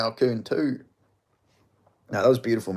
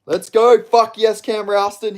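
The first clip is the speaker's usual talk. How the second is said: loud, raised victory shout; loud cheer; 5 semitones higher, 5 dB louder, slower than usual. Real, not acted. Fan celebrating his picked fighter winning, loud cheer. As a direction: punch out the cheer.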